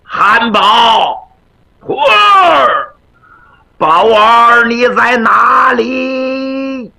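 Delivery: 拖长声音高声呼唤徒儿; 像隔着院子扯嗓子喊人; 远远地呼喊. Acted. 和尚远处高声呼唤徒弟. (拖长调子远远呼喊)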